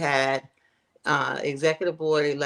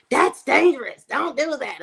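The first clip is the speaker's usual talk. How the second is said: warning parent; 9 semitones higher, 4 dB louder than usual. Retold or imitated. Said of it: "I'm like".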